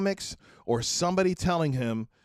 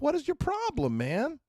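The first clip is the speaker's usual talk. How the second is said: exasperated retort; 13 semitones higher than usual. Retold or imitated. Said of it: "I'm like".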